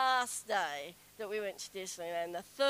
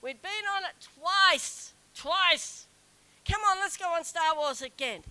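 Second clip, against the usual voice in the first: eager, pushy plea, higher and louder; Kevin pleading; 6 semitones higher, 6 dB louder than usual. Retold or imitated. Husband urging his wife onto the ride again, eager and loud. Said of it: "And Kevin says".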